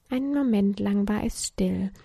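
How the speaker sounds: plain reading voice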